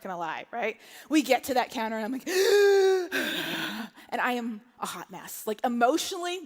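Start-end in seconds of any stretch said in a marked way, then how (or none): 2.3-4.1 loud, long heaving breath; exaggerated out-of-breath wheeze; winded gasp. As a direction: gasp as if just done sprinting. Arrives out of breath at counter.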